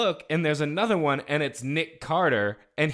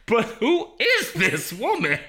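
mock baffled; 9 semitones higher, slower than usual.

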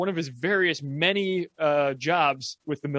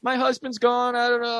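high-pitched retold panic, easing as it goes; imitating someone flustered and panicked; mock panic; 7 semitones higher than usual. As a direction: play a friend panicking, pitch starting high and settling. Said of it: "She's all in a panic".

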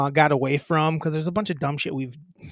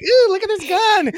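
high, loud mock awe; mocking Jonah Hill; 7 dB louder than usual. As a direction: gush over a toy gun. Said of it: "that was his vibe with it".

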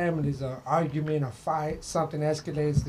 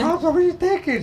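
incredulous son; 11 semitones higher, 4 dB louder than usual. Retold or imitated. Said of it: "I'm like".

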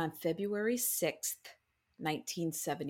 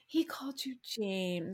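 whispering. Real, not acted. Teasing aside to cohost.

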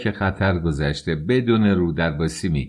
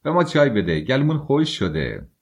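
shouting; 6 semitones higher than usual. A narrator's reading. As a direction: holler for the tea, throat dry. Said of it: "رازومیخین فریاد زد".

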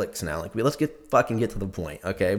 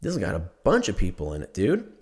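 ordinary talk, nothing marked

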